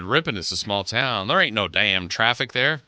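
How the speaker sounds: put-on twangy small-town local accent, punched up at the end. Radio host reads a listener's chat comment, small-town accent. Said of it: "I don't know why I did the accent".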